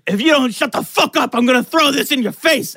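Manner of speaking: high-pitched, irate mock threat; angry retold threat. Naked roommate threatening the one who mocked him, angry. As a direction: imitate a furious roommate making threats.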